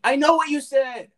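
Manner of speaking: high, loud put-on retort; mock-exasperated squawk; insistent character voice. Playing character insists after refusal.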